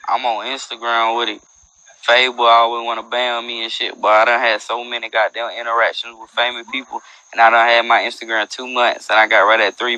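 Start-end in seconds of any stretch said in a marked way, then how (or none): none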